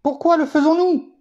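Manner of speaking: high and ringing, challenge them; preacher challenging the congregation, voice leaping high; pointed rhetorical question. Acted. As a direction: throw the question out to the hall.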